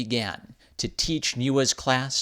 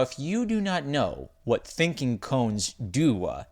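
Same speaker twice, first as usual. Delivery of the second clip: plain reading voice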